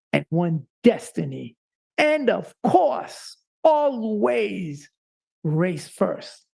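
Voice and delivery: stirring creed recited aloud; rousing pledge. Real, not acted. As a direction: lead a call-and-response pledge. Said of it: "please repeat after me".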